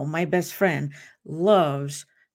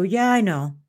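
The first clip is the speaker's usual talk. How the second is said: offhand, bored "Yeah, I know" with a falling end; dismissive shrug; 4 dB louder than usual. Retold or imitated. Shrugging off a familiar statement, dismissive and bored. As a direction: toss it off like old news. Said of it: "we take that statement right there and we go".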